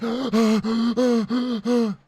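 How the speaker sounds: a sharp, hammed-up gasp of horror; exaggerated gasp. Ranting gamer mocks his own shock at a bad game, gasping. Ranting about feeling trapped.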